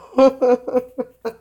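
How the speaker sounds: high-pitched burst of laughter. Real, not acted. Laughs mid-message.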